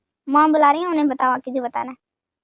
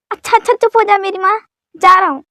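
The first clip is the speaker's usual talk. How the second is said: loud, raised-pitch hushing; exasperated surrender; 4 semitones higher, 7 dB louder than usual. Acted. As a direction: give in loudly to shut her up. Frantically hushing her, giving in.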